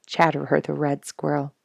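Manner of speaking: plain reading voice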